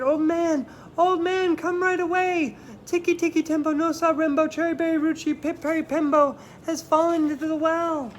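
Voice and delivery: high, loud call for help. Acted. Chang calls the dozing old man, high and loud. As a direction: call up the hill to wake him.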